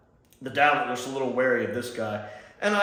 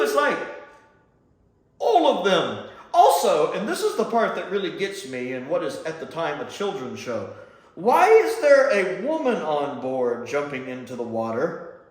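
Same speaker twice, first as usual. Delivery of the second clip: ordinary talk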